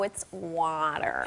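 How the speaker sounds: slow American "water", WAH-der, laughing; child insisting in American accent. Retold or imitated. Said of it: "she was like".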